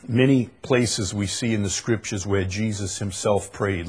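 ordinary delivery

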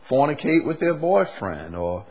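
loud, rising preacher's call; preaching at full force. Acted. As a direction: press the congregation hard from the pulpit.

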